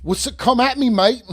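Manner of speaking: soccer hooligan. Pretend. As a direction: throw out a jokey hooligan challenge. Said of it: "Now you're a soccer hooligan?".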